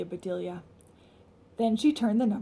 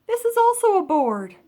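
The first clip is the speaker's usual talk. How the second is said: emphatic; 10 semitones higher, 8 dB louder than usual. Shown as a demonstration. Teacher stressing word meaning.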